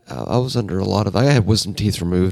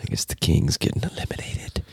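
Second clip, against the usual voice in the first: whispering; 3 semitones lower, 6 dB quieter than usual. Real, not acted. Aside about the King's elimination.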